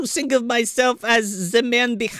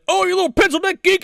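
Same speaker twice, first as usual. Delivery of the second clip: mock colonel; 12 semitones higher, 4 dB louder, faster than usual. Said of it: "the colonel's like".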